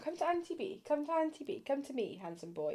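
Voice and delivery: soft cooing coax to the dog. Real, not acted. Coaxing the dog to come.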